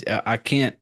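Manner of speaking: normal talking voice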